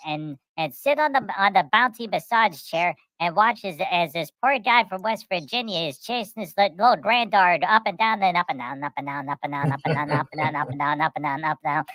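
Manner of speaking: ordinary talk, nothing marked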